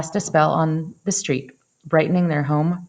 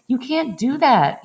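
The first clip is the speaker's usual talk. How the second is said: yelled; 6 semitones higher than usual. A narrator's reading. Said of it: "yelled neighbors".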